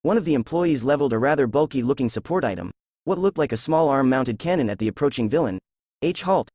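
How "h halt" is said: yelling. Acted. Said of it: "he yelled".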